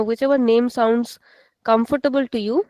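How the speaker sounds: ordinary talk, nothing marked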